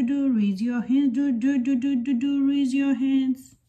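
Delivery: usual speaking voice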